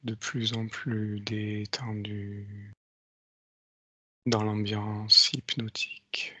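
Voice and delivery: slow, soft hypnotic countdown; slow hypnotist voice. Acted. Leads a hypnotic induction. (voix lente d'hypnotiseur)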